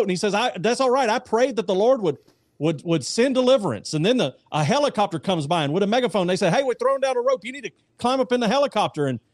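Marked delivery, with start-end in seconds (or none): 0.3-3.9 joke stubborn man. Acted. 6.5-9.1 megaphone rescuer. Acted. Helicopter crew on megaphone.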